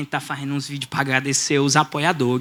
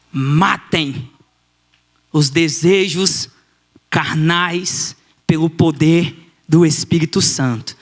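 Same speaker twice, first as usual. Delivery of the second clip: slow, phrase by phrase; measured, deliberate reading of Scripture; solemn scripture quote; much slower than usual. Retold or imitated. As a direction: declaim the verse with weighty pauses. Quotes Paul's text to the boy.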